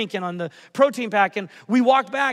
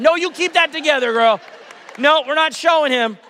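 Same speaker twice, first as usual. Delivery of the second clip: higher, louder playful scold; mock scolding dad; 6 semitones higher, 7 dB louder than usual. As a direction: tease her like a stern father. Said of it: "I said".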